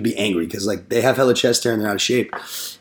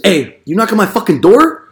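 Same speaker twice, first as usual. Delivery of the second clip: higher, louder mock confrontation; angry neighbour; 8 semitones higher, 6 dB louder than usual. Tattooed homeowner confronts door knockers, mock angry shout. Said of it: "he walks right up and says".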